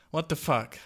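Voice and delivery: ordinary delivery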